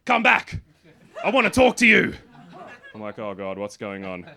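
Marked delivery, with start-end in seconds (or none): 0.0-2.1 raised, high mock holler; imitated calling after someone. Audience lady chasing the comedian up stairs, imitated call. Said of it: "comes chasing after me". 3.2-4.3 low, soft, slow aside; retold quiet dread. Comedian chased by an audience member, low uneasy aside.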